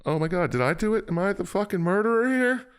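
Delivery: panicked hero realizing guilt; mock panic. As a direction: squeal it out in fake horror. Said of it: "And you're like".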